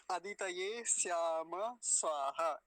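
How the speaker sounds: अदितये श्याम स्वाहा, slow and chanted; slow recitation. Shown as a demonstration. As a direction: recite the whole line slowly. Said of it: "ऐसा इसका उच्चारण होगा". (धीरे पाठ)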